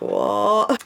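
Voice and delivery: imitated nervous yelp, drawn out; mock nervous reaction. Imitating mum and brother hearing the plan, mock nervous. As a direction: mimic a nervous relative's reaction. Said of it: "like, really nervous".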